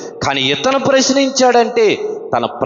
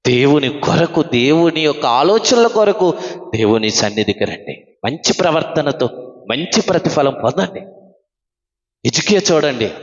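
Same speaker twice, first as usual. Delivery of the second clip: normal talking voice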